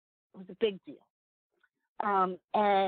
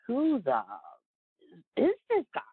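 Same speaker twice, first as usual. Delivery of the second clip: mock disbelief; 2 semitones higher, 3 dB louder than usual. Retelling disciples amazed at Jesus calming the storm, mock disbelief. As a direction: imitate baffled onlookers, pitch leaping up. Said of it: "and they're like".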